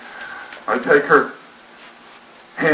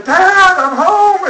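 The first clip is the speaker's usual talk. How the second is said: much higher and louder, a joyful shout through the house; excited calling out; 6 dB louder, much slower than usual. Retold or imitated. Erica bursting into the house calling to her new dad, high and loud. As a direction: announce yourself like a kid bursting in.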